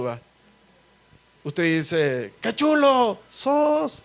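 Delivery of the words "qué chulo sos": high, slow call to the far shore; shouting across the lake. Retold or imitated. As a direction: call it out slowly, as if to be heard on the other side. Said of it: "Usted grita ahí".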